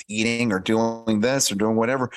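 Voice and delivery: usual speaking voice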